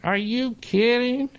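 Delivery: high-pitched, amused disbelief; incredulous retort. Retold or imitated. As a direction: rise high as if you can't believe it. Incredulous retort to mother's remark.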